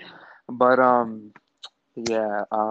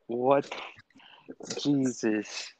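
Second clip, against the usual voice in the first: ordinary talk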